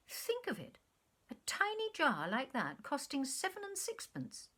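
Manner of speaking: high incredulous exclamation; mock astonishment. A narrator's reading. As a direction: gasp at the price. His shocked mimicry of price.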